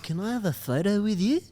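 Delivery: fan asking. Retold or imitated. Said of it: "He's like".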